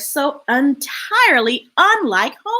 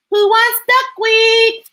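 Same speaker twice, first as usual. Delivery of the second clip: high, loud offer to a crowd; excited call-out; 9 semitones higher, 5 dB louder, slower than usual. Acted. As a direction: call the whole flock to eat. Duck calls to the flock.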